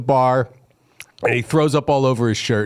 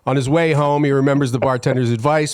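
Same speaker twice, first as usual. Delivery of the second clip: ordinary talk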